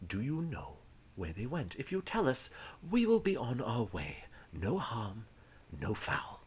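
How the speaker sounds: soft, whispery, measured interrogation; hushed, courteous threat; polite menacing pirate officer. Acted. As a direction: coax the captive with quiet menace. Game master plays pirate officer.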